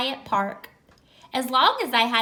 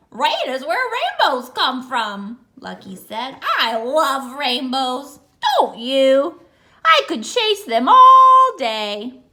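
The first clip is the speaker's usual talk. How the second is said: much louder happy dog gushing; excited dog; 9 dB louder than usual. Acted. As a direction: gush about rainbows loudly. Cheerful dog on rainbows.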